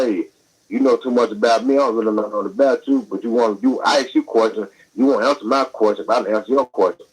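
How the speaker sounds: normal talking voice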